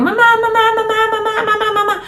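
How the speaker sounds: put-on high, insistent little-kid whine calling mom; whiny child. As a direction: nag like a child tugging at mom. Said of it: "escucha al niño". (voz aguda de niño insistente)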